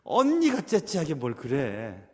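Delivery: teasing reproach, acted; imagined onlookers' chiding. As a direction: voice a disapproving bystander, drawn out. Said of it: "사람들이 이렇게 얘기 안 할까요?".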